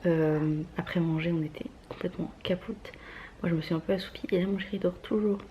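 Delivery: hushed, half-whispered talk. Real, not acted. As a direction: keep it down, someone's sleeping. Partner asleep, speaking hushed.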